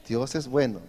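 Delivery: soft, low, fading praise; whisper-soft devotion; quietly reverent, dropping low; reverent hush. Real, not acted. Preacher's soft reverent repetition.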